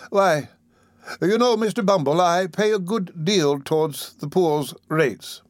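an oily undertaker angling for profit; fawning undertaker. Acted. Undertaker speaks to beadle.

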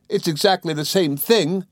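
high, insistent wife's protest; aggrieved cry. A narrator's reading. Dora refusing her husband's explanation, crying out. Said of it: "cried Dora".

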